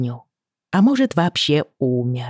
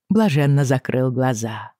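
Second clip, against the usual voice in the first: usual speaking voice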